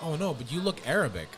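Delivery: ordinary talk, nothing marked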